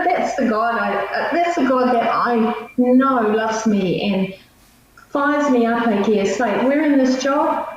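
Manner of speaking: ordinary delivery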